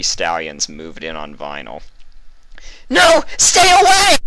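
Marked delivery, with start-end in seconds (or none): 2.9-4.2 a very high, loud screech. A narrator's reading. Vinyl fighting off three stallions, screeching. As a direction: screech it in terror. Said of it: "Vinyl screeched".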